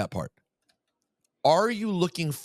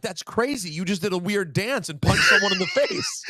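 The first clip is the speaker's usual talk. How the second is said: amused mock exclamation; 9 semitones higher than usual. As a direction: blurt it out like you can't believe what you saw. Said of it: "And you're like".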